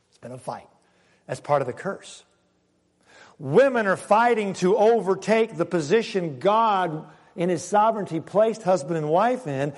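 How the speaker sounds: ordinary delivery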